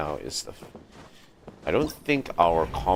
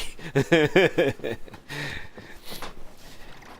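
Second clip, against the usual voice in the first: higher drawn-out laugh; real laughter; 10 semitones higher than usual. Real, not acted. Gamer still laughing at the kill, drawn-out laugh. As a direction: keep laughing, amused.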